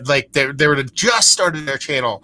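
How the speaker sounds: ordinary talk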